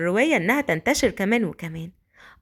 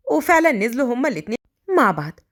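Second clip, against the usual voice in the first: plain reading voice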